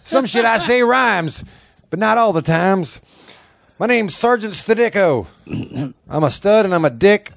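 high, barking drill sergeant; drill instructor. Pretend. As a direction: bark orders like a drill sergeant. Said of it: "a character of a drill instructor".

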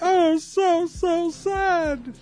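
very high, slow whimpering fake sadness; hammed-up falsetto pity-me whine; mock weepy girl. Reader mocking a sister crying over the email, very high fake whimper.

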